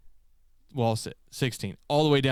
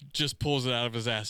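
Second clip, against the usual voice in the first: normal talking voice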